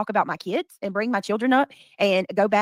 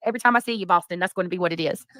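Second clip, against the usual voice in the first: ordinary delivery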